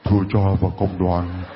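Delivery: low, mock voice as if back from the dead; mock ghostly mumble. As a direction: imitate him as a feeble ghost, teasing. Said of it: "như từ cõi chết xuống". (giọng nhại yếu ớt như ma)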